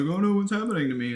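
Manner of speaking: high, alarmed mock baby voice; startled baby. Mocking a baby in peekaboo, a high mock-alarmed voice. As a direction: play the baby who lost the face. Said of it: "they're like".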